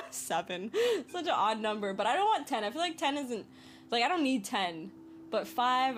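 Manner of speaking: ordinary talk, nothing marked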